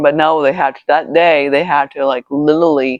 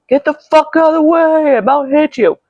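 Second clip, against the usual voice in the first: high-pitched mock yell at a stranger; imitated scream; 12 semitones higher than usual. Driver screaming at a pedestrian in the car park, imitated high-pitched yell. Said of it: "This guy completely screamed".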